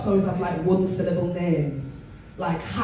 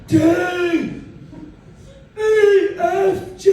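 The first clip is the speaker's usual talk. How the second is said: posh English teacher; 8 semitones higher, 4 dB louder, much slower than usual. Retold or imitated. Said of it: "teaching them English like".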